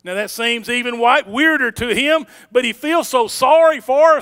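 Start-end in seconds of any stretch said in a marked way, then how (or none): none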